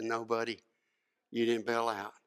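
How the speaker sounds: low, soft coach's comfort; tender reassurance. Retold or imitated. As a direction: gently reassure a young player.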